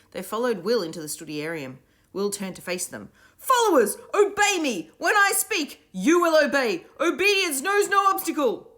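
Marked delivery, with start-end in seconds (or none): 3.4-8.7 high, loud order to stand; commanding shout. A narrator's reading. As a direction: command the crowd to rise. Will commanding the crowd.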